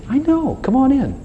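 very high, unforced welcome; reassuring invitation. Acted. God to the confessing sinner, reassuring invitation. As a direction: coax them in with a lifted voice.

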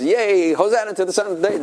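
put-on high, fast cheering child voice; mock children's cheer; excited kids. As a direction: cheer like a little kid. Kids cheering on Palm Sunday.

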